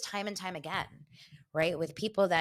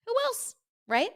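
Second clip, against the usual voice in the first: rhetorical; 3 dB louder than usual. Real, not acted.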